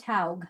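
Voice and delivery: Haug said as "howg"; correct surname pronunciation. Shown as a demonstration. Guest corrects host on her surname, Haug pronounced carefully. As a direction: say Haug with an "ow" vowel. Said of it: "My last name is pronounced".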